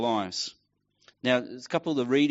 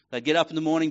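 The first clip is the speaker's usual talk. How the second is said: ordinary delivery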